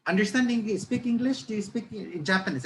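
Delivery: high-pitched, halting imitation of a friendly stranger; friendly Japanese passenger. Japanese passenger striking up conversation on the plane, friendly imitation. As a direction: lightly mimic a foreigner trying broken English. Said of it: "trying to be friendly with me. He said".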